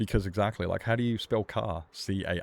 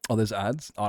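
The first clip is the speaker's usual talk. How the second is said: ordinary talk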